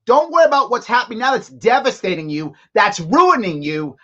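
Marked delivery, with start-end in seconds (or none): none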